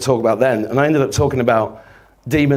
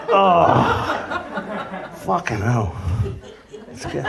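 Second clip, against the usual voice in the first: wincing from a pulled muscle; in pain; 4 semitones higher, 4 dB quieter, much slower than usual. Real, not acted. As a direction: groan through muscle pain. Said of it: "I've pulled a muscle".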